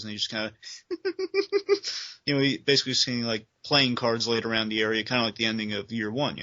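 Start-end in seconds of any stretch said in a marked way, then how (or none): 0.6-2.2 high, rapid maniacal giggle; imitated Joker laugh. Man fallen in chemicals starts cackling, high imitated giggle.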